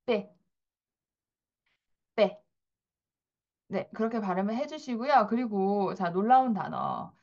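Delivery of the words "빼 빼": 빼 with a tense, unaspirated p. Shown as a demonstration. Teacher models tense 빼 for learners. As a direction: pop 빼 with a hard tense p. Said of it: "된 발음으로 이렇게 발음을 해줍니다".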